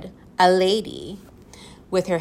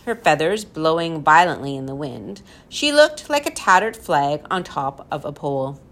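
ordinary delivery

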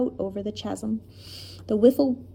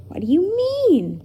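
high, loud astonished question; aghast; 9 semitones higher, 6 dB louder than usual. A narrator's reading. Tom to the professor who cannot see the bridge, aghast. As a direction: burst out in disbelief, pitch leaping. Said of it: "Tom was aghast".

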